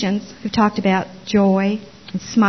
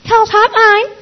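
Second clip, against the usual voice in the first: high-pitched, louder mock hotline greeting; 12 semitones higher, 6 dB louder, slower than usual. Startling voice answering the hotline call, high imitated greeting. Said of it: "then this voice goes".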